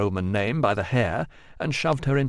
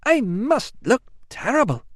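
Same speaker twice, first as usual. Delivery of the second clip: high, sudden blurt; 13 semitones higher than usual. A narrator's reading. Amelia blurting to her cousin about her looks. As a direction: blurt it out in a fluster. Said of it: "blurted Amelia suddenly".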